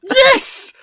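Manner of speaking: very high, loud cry; vehement agreement. Real, not acted. Co-host agreeing about the plot hole, explosive high yes.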